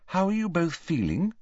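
high, tentative question. A narrator's reading. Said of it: "she asked tentatively".

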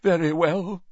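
high, offended acceptance; deeply wounded. A narrator's reading. Trelawney yields to Dumbledore, deeply wounded. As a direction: give in as if gravely insulted. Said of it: "in a deeply wounded voice".

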